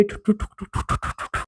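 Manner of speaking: soft imitated helicopter rotor thuds; helicopter noise. A narrator's reading. Narrator imitating the helicopter arriving overhead, softly. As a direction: mouth the chopping blades, softly fading. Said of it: "helicopter की आवाज आई".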